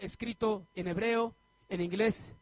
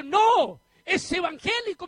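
emphatic no; 7 semitones higher, 8 dB louder, slower than usual. Retold or imitated. Friend corrects him, emphatic.

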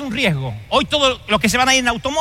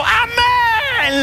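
shouted amen; 12 semitones higher than usual. Retold or imitated. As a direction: shout Amén! up high like a mother glad to see her son go. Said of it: "le gritó al hijo".

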